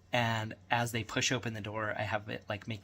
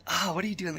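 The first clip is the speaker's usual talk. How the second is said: a bit higher mock-exasperated voice; lightly voiced wife scolding; wife reacting to the gory kills; appalled wife; 8 semitones higher, 4 dB louder, faster than usual. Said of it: "my wife is always".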